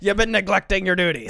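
imitated boss's reprimand; mock scolding. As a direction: bellow it like a comic taskmaster. Quotes Defoe scolding from hatch.

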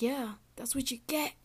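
loud taunting mock boast; mock tough guy. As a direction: ham up the smug filmer. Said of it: "filming yourself and being like".

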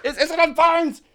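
high, loud shout to someone off mic; yelling through the wall. Pretend. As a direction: holler it to the next room. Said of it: "I'm yelling at them through the wall".

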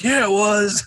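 high-pitched mock call from the seats; heckler calling out. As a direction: call it back at the stage. Somebody in crowd heckles back.

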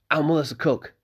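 put-on eager young innkeeper's son; young Malcolm.

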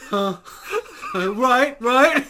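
laughing mock alarm. Acting out the ghost hunt, breaking into laughter. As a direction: spook yourself and crack up.

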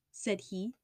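ordinary delivery

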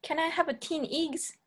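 put-on Kiwi accent, "teen" for ten. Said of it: "Kiwi accents is so different".

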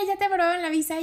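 excited disbelief. Retold or imitated. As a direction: ask it in thrilled disbelief. Brother's friends hear the news.

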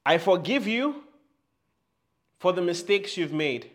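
loud and high preaching call; fervent, loud affirmation; loud declaration. Shown as a demonstration. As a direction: proclaim forgiveness boldly. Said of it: "As loud as you can".

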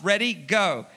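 ordinary talk

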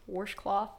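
"Washcloth" with an added r, "worshcloth"; grandmother's pronunciation. Retold or imitated. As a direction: repeat "Washcloth" as "worsh-cloth". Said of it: "My grandmother says".

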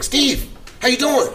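high, slow, eager greeting; excited caller. Retold or imitated. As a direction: sing it out like a thrilled team rep. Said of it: "And they, they excited".

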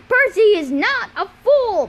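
loud, high outburst in a put-on voice; angry shout. A narrator's reading. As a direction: roar it out in a friend's defence. Tyson charges Luke defending Percy.

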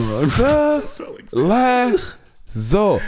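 very slow high showman call; drawn-out grand title; grand self-announcement. Pretend. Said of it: "Say it with me".

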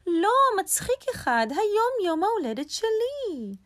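very high rabbit voice, protesting; indignant rabbit. A narrator's reading. Rabbit corrects the squirrel about whose birthday it is, indignant.

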